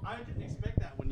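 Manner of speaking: ordinary talk, nothing marked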